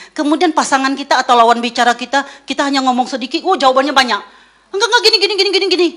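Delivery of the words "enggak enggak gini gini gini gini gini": high, rushed mock interruption of a chatty partner; eager interrupting. Partner who talks a lot eagerly cutting in. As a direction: talk over them, keen to explain.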